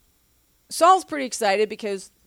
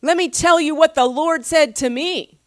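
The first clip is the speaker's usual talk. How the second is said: louder and raised, laying down the word; stern rebuke; 6 semitones higher, 6 dB louder than usual. Retold or imitated. Samuel rebuking Saul, louder and firm. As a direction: tell him off firmly. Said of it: "he's just like".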